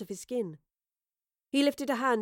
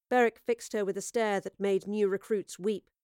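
ordinary delivery

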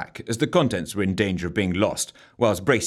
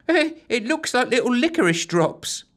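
put-on timid girl voice. A narrator's reading. Bracy voiced high.

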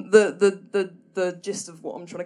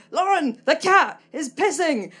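higher, louder call from downstairs; imitating mum calling up the stairs urgently; mum shouting; 8 semitones higher, 7 dB louder, slower than usual. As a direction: yell up the stairs in alarm. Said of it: "my mum would shout from downstairs".

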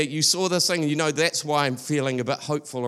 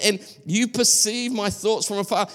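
ordinary delivery

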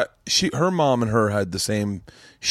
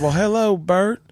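high, sing-out Southern greeting; imitated Southern mother-in-law; Southern accent; 9 semitones higher, slower than usual. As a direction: greet like a Southern mama, voice pitched up. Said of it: "have a southern accent".

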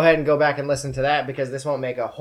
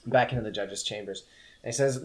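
ordinary delivery

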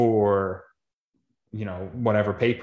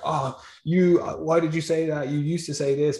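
accusing high voice; 7 semitones higher than usual. Retold or imitated. People turning on a changed mind.

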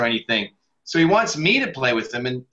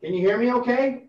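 distant, echoey mic sound; talking from inside a tunnel; echoing tunnel; 8 semitones higher than usual. Real, not acted. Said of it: "you sound kinda like in a tunnel".

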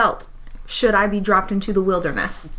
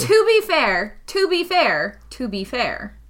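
playful repeated interjection; slower than usual. Pretend. Player playfully interrupts to defend her view, stepping down in pitch.

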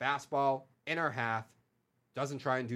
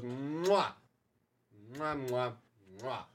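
between kisses; much slower than usual. Acted. Chef's kiss over Yankees' exit.

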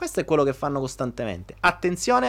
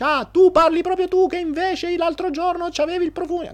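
much higher, loud mocking retort; counterattack jab; 3 dB louder than usual. Partner attacked fires back about the perfume, high mocking retort.

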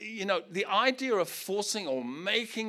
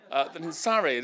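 ordinary talk

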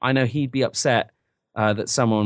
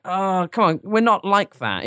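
higher-pitched, drawn-out objection; mild mock protest; 7 semitones higher, slower than usual. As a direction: protest it like a put-upon Englishman, lightly acted. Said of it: "And you're like".

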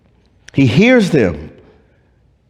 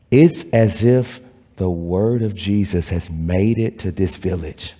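hushed preaching; 7 semitones lower, 4 dB quieter than usual. Acted. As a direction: draw them in with a reverent near-whisper.

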